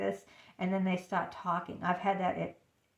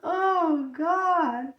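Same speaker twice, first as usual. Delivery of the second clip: high, loud sigh after laughter; laughing groan; 8 semitones higher, 7 dB louder than usual. Real, not acted. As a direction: let it out helplessly after a laughing fit. Recovering from her laughing fit.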